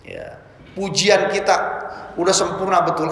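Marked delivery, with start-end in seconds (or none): none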